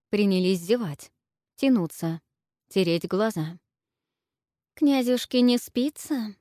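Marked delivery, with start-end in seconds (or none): 4.7-6.4 purring, slow, higher seductive voice; femme fatale. A narrator's reading. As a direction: purr it like a temptress. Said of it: "промурлыкала чернявая". (мурлыкающая соблазнительница)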